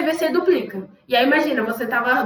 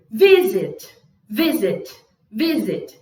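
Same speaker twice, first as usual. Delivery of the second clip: Visit strong first, falling away after; stress on vi; 3 semitones lower, much slower than usual. Shown as a demonstration. Teacher drawing a vocal graph, Visit stressed on Vi. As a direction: punch Vi and slide down on sit in Visit. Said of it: "claramente tônico no V".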